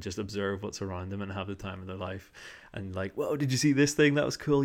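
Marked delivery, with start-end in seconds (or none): 3.1-4.6 high, louder gee-whiz wonder; amazed friend. Retold or imitated. Friend on a walk marvelling at a find, excited. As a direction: marvel like a curious kid.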